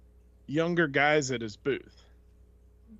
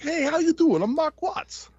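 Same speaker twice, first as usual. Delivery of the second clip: high, louder Brooklyn wise-guy greeting; much higher, punchy New York tough-guy voice; Vinnie Barbarino voice; 14 semitones higher, 6 dB louder than usual. Retold or imitated. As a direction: imitate Barbarino saying hello. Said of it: "what's his face from, uh, Welcome Back, Kotter".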